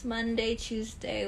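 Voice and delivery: normal talking voice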